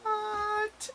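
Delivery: very high, startled gasp of a question; shocked squeak. Retold or imitated. Lizzie shocked voice.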